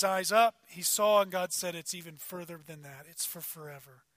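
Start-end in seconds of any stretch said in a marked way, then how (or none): 1.7-4.0 a hushed God promising Abe something beyond sight, softly. Acted. Preacher voicing God to Abe, quiet and intimate. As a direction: drop to a near-hush, much softer. God's promise voiced in sermon.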